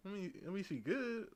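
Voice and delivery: soft, hesitant reply; awkward hesitation. Retold or imitated. Answering the old man about his girlfriend, soft awkward. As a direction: stall awkwardly. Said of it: "I'm like".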